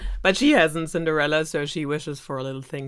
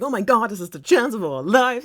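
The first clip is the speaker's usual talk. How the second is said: higher, louder mock gushing; excited stepfamily; 6 semitones higher, 6 dB louder than usual. Stepmom and stepsisters hearing of the ball, thrilled. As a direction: act out an excited exclamation, pitched up and punched.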